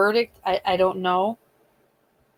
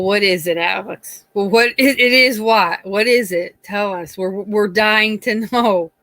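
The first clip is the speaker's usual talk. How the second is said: teasing; 8 dB louder than usual. Real, not acted. Teasing a co-host loudly.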